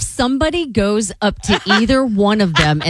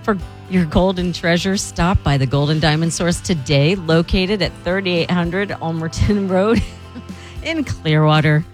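smooth live ad read over music; luxury ad. Acted.

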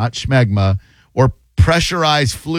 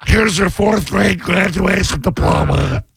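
high, far-from-natural comic principal voice; hammed-up principal, drawn out and pitched up; principal impression; 6 semitones higher, slower than usual. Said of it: "It's the best impression I do".

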